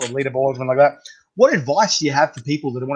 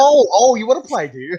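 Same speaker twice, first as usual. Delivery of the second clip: high, louder mock threat; mock taunting challenge; 9 semitones higher, 5 dB louder than usual. Chili rivals taunting a boastful newcomer, mock challenge. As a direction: tease like a rival sizing someone up. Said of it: "They'll be like".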